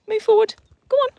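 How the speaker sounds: high, loud call; loud high command; urging. Real, not acted. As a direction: push the horse on. Urging horse on.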